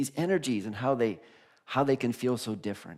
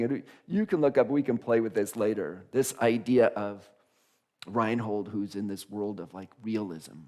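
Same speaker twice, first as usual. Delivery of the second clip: ordinary delivery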